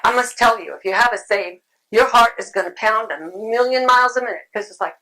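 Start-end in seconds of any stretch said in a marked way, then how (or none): none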